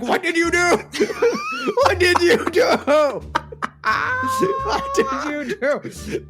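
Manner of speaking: high, laughing, wailing disbelief; laughing panic. Pretend. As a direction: wail it through laughter. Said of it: "agents ... being like".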